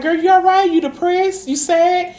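high, sing-song fake worry; mock concern. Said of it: "I be like".

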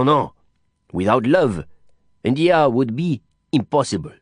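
an expansive Frenchman explaining India with relish; French Didier holding forth. A narrator's reading. Didier continues his speech.

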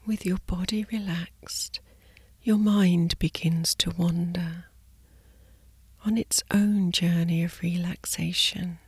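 soft slow sleep whisper; soft spa whisper. Acted. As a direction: whisper them gently to sleep. Guided sleep meditation body scan.

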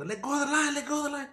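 high yelled warning, mock urgent; retold shout. To buddy grabbing the fishing line, a retold high shout. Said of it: "I go".

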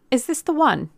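high, loud, sharp demand; sharp question. A narrator's reading. Man recovering from surprise, asking the girl sharply. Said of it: "he asked sharply".

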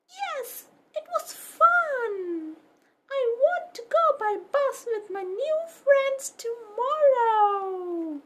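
high, loud eager puppy voice; happy puppy. Acted. Puppy Pepper tells Mama school was fun, high and eager. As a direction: brim over with news.

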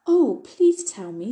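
wide-eyed fairy begging to be told; eager. A narrator's reading. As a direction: plead eagerly, stretching the words. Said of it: "said Dulcie eagerly".

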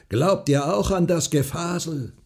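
high, hard challenge. A narrator's reading. Farmer scoffs at the neighbour's belief, hard. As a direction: throw it at her harshly. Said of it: "wiederholte der Bauer hart".

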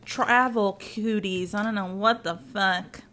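ordinary delivery

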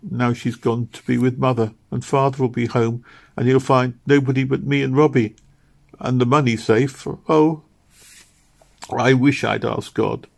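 a touch of sobbing despair; grieving girl. A narrator's reading. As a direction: pour out grief beside the bed. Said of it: "she cried to herself".

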